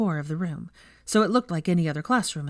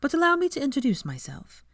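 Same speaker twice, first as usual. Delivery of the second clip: lightly raised, smiling teacher voice; a hint of a friendly young professor; gently smiling introduction; Hermione, smiling; 9 semitones higher than usual. A narrator's reading. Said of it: "still smiling".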